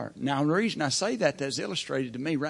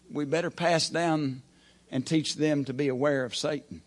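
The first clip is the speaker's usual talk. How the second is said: ordinary talk, nothing marked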